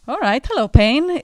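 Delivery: jaunty hello, mock cheerful and loud; mock cheerful greeting. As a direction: call out to pain like an old friend. Greeting pain like a friend.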